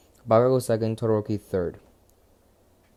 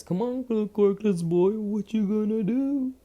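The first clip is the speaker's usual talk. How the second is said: mocking bully voice; 10 semitones higher than usual. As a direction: taunt him like a smug rich kid, pitched up. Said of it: "And he says".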